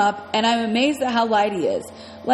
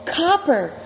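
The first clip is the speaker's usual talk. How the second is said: scream; 5 semitones higher than usual. A narrator's reading.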